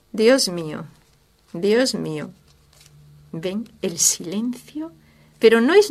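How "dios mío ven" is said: ordinary talk, nothing marked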